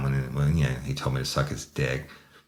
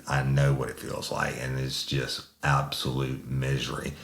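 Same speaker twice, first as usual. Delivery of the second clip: usual speaking voice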